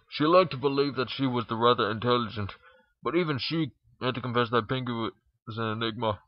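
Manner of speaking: ordinary delivery, nothing marked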